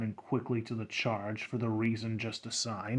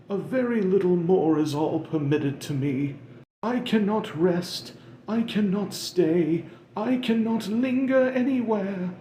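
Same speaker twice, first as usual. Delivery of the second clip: higher, louder mournful ghost voice; Marley's wailing ghost; 8 semitones higher, 5 dB louder than usual. Acted. Marley's ghost lamenting to Scrooge, higher and mournful. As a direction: moan it like a doomed spirit.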